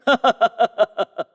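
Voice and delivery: gloating, staccato laugh; mocking villain laugh. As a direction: laugh scornfully in a falling run. Villain gloats over the deception.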